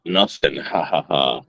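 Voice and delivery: ordinary talk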